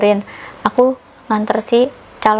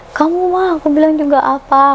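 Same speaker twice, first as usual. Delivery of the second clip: tearful reproach; 5 semitones higher than usual. Retold or imitated. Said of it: "nangis-nangis ke dia".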